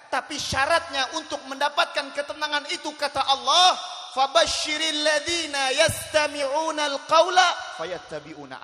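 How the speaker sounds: very loud, high recitation; shouted preacher's proclamation; full-force preaching. Real, not acted. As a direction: build to a ringing, stretched-out verse.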